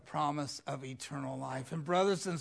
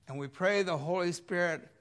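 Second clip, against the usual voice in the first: normal talking voice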